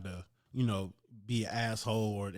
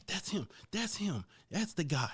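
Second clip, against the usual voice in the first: higher, eager finger-pointing tattle; mock accusing; 7 semitones higher than usual. Snitch pointing him out to the gym owner, higher eager tattling.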